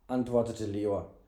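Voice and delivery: usual speaking voice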